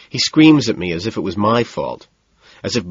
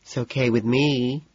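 lisping reply; 5 semitones higher, 3 dB quieter than usual. A narrator's reading. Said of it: "the voice in the next stall finally lisps".

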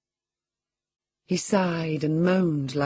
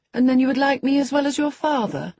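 put-on higher, brighter boyish question; more cheerful; 11 semitones higher, 5 dB louder than usual. A narrator's reading. Boy hoping his cousin will like him, more cheerfully. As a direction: perk up hopefully, pitch raised. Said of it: "observed he more cheerfully".

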